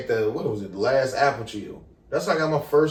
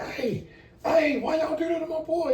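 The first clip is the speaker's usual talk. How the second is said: imitated protest; 5 dB quieter than usual. Friend protesting police rough treatment, imitated high protest. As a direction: object on a friend's behalf, voice pitched way up.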